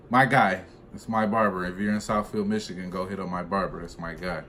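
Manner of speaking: ordinary delivery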